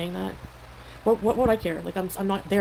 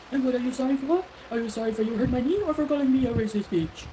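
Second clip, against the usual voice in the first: imitated retort; 5 semitones higher than usual. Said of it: "and the guy's like".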